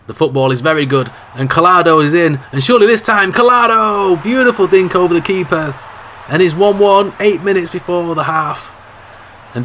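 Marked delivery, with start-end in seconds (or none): 1.4-8.7 high, loud burst on the finish; excited goal call. Acted. Commentator calling the equaliser, loud excited yell. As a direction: shout the name as the ball goes in.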